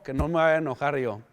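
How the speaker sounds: ordinary talk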